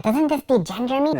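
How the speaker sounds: very high-pitched mocking squeak; high, whiny-question caricature of a complainer; mock objector. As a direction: put on a high voice for a worried objector. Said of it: "some folks who are like".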